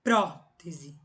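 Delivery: prótese with a loud first syllable, PRÓ-tese; "Prótese" opened hard on the antepenultimate syllable; stress on PRÓ. Shown as a demonstration. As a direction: punch PRÓ, then let "tese" drop. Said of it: "Todas as palavras proparoxítonas são acentuadas".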